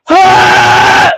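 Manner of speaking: sharp waking yell, low and loud; startled yell. Retold or imitated. Waking from a smoking dream with a loud yell. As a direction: yell like jolting out of a nightmare. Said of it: "and I'm like".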